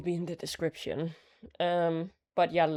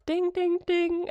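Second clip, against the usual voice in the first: high-pitched "ding" chimes; imitated bell dings; 13 semitones higher, slower than usual. Announcing another gift knit, high imitated bell dings. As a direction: ring it out like a little bell.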